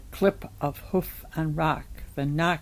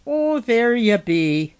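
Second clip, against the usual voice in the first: higher, slow, loud drawn-out greeting; slow character voice, pitched up; July, greeting; 4 semitones higher, 6 dB louder, slower than usual. A narrator's reading. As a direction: draw the words out in a raised voice.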